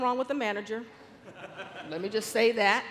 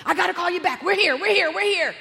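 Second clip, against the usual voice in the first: loud, high, repeated announcement; excited urgency; 8 semitones higher, 7 dB louder than usual. Retold or imitated. Sister-in-law reaching school rushes off phone, excited and loud. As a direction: blurt it out urgently, voice raised.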